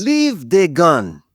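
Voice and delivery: put-on slow, weighty Nigerian accent. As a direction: intone it like a West African elder. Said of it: "use a Nigerian accent".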